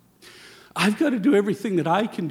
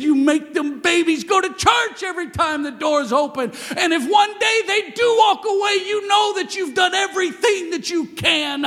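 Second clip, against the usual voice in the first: shouted, much higher and louder; preaching at full force; 11 semitones higher, 4 dB louder than usual. Acted. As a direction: bellow it across the church. Preacher shouting the uncle's charge.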